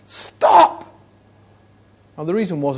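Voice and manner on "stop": high, loud, urgent shout; mother crying out to a child on a scooter. Retold or imitated. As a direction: cry out to halt her child. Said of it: "she cried out".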